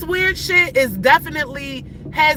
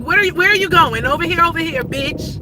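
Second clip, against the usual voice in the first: loud, higher angry call-out; yelling at driver; 5 semitones higher, 4 dB louder than usual. Real, not acted. Calling out the driver tailing her car, loud and angry. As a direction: shout at the tailgating car.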